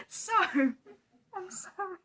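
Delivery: high, drawn-out apology nearly crying with laughter; laughing apology. Real, not acted. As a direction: slowly squeak it out through giggles. Apologising during a giggle fit.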